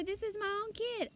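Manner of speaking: high indignant protest; indignant father. A narrator's reading. Father protests to the cashier, indignant. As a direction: protest in disbelief.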